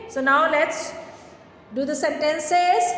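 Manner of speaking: slowed, drawn-out classroom announcement; slow dictation. Shown as a demonstration. Teacher slowly announcing sentences to young class. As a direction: spell the words out slowly for children.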